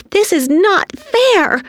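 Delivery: high, drawn-out indignant shout. A narrator's reading. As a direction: shout it out high as a protesting girl. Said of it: "Tina shouted".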